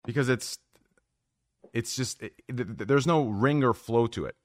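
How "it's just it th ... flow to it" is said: usual speaking voice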